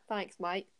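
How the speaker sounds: Southern slipping into Australian; brief put-on Australian accent. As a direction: thank them in an Australian accent. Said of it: "That's, uh, Australian there".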